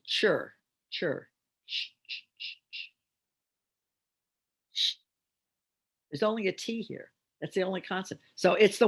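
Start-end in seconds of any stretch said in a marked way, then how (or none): 0.0-5.0 CHUR reduced to a bare "ch"; sounding out. Shown as a demonstration. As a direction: say "chur", then isolate the voiceless "ch". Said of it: "Second syllable".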